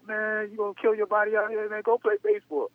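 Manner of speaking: a high, half-joking friend scolding him; teammate warning. Retold or imitated. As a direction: play the buddy telling him to quit football. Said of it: "He's like".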